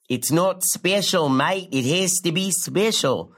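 drawn-out, hammed-up Australian boyfriend; bad Australian accent. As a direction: do a terrible Australian impression. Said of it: "doing a terrible Australian impression".

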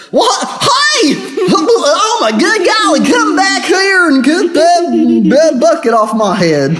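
very high, drawn-out sitcom hillbilly holler; Dukes of Hazzard character. Acted. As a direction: ham up a high-pitched country yokel. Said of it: "Dukes of Hazzard character or something".

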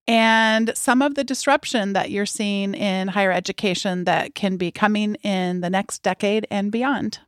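ordinary talk

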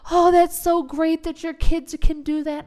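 high, loud, syrupy mock praise; gushing coworker. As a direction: gush over it like an overdone admirer.

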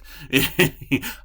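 short high chuckle; amused laugh. Real, not acted. Reporter recalling his surprise at the player's offer, amused laugh. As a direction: chuckle at your own memory.

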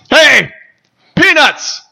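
yelling peanuts at the ballpark, high and loud; stadium vendor hawking. Retold or imitated. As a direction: bellow it across the stands. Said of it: "this was the guy's sale pitch".

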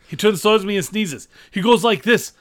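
loud nervous comic storytelling; Rodney Dangerfield. Acted. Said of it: "do a Dane Cook routine as Rodney Dangerfield".

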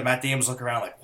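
ordinary talk, nothing marked

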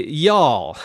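"Y'all." in a light Texas accent, one gliding syllable; Texas drawl. Shown as a demonstration. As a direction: say "Y'all." like a Texan would. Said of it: "down in Texas, they'd say".

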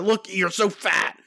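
high, drawn-out mock yell; mock scream. Manjula screaming at Marge in a pitched scene, mock scream. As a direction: pretend to scream an insult, stretched out. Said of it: "not just scream at her".

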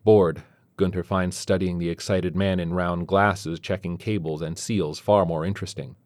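usual speaking voice

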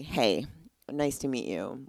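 ordinary talk

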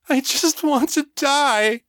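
high-pitched, anguished outburst; tearful high wail; anguished cry. A narrator's reading. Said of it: "Miriam cried".

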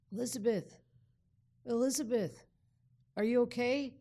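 worried calling out. Retold or imitated. And then I heard a voice.

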